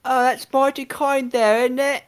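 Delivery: high chirpy Cockney street urchin; old london cockney. Acted. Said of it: "I'm gonna put on my, my best pauper".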